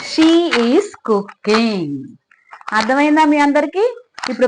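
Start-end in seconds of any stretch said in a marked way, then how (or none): 0.1-1.9 very slow, each word set apart for the class; slow teaching demo. Shown as a demonstration. Teacher modelling a sentence for pupils, very slow and deliberate. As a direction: spell it out slowly so children follow. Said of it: "pronoun అంటాము".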